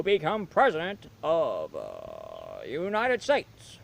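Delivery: stately presidential address, stretching the words; Barack Obama impression. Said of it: "I can do Barack Obama".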